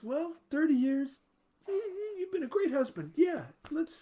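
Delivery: high, halting voice of a hesitant wife; reluctant wife stalling, voice lifted. Acted. Wife hesitating over husband's request.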